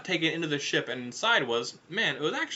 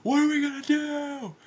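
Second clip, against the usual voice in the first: high, wailing cry; panicked scream; 13 semitones higher, 4 dB louder than usual. Acted. Big Zam and Wendy screaming together, high panic. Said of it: "They both screamed in unison".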